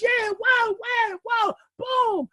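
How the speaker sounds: high shouted whoas and boom; fireworks excitement. Retold or imitated. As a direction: whoop like fireworks going off. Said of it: "those are the fireworks".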